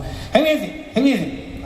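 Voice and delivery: put-on high old-timer voice from indoors. Musician behind a closed door to a stranger, high-pitched. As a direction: call out high and suspicious. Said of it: "hear this voice inside going".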